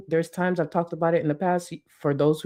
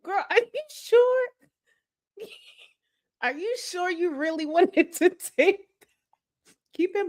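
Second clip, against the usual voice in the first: mocking disbelief; 11 semitones higher than usual. Mocking the streamer.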